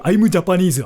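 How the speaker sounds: JAPANESE said with a thick Japanese accent; exaggerated Japanese accent. Shown as a demonstration. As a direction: put on heavy Japanese-accented English. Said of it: "I intentionally spoke English like this".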